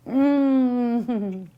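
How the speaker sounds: long, drawn-out "mmm" of pleasure; savoring hum. Real, not acted. Host tastes the meal, long savoring hum. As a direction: hum contentedly over a delicious bite. Savoring her food on camera.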